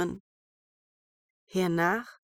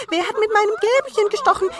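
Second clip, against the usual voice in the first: sehr hoch, laut und schnell; vierter Zwerg; 13 semitones higher, 8 dB louder, faster than usual. A narrator's reading. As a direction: aufgeregt hoch losplappern. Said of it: "Der fünfte". (hohe, laute Zwergenstimme)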